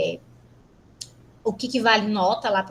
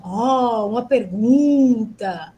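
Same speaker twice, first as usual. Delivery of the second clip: drawn-out, slow mock surprise at a question mark; a hint of surprised reading-aloud tone; questioning intonation demo; 4 dB louder, much slower than usual. Shown as a demonstration. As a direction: read it as if discovering a question. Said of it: "entonação de voz".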